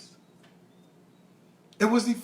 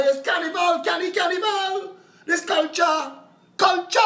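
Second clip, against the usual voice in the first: carnival hype; 10 semitones higher than usual. Acted. Comedian hyping up the carnival culture, excited. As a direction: hype it up like a fete crowd.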